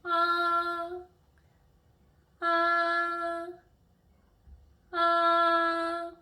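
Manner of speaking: AH held long on a high flat tone, loud; first tone. Shown as a demonstration. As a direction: sustain a high, level "ah". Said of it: "is a flat tone".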